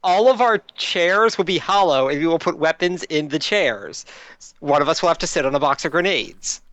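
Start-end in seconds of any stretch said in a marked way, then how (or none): none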